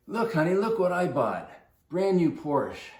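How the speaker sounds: mock proud husband showing off; proud excited call. Husband calls his wife out to see the car, proud and excited.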